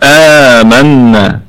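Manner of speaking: آمنا with the opening آ stretched slowly; lengthened madd. Shown as a demonstration. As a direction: draw out the آ in آمنا. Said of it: "نطيل الصوت بالهمزة شوية". (آمنا بمد الألف مدًا طويلًا ببطء)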